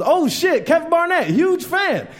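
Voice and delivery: high-pitched excited recognition; starstruck fan. Retold or imitated. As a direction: gush like a fan spotting a celebrity.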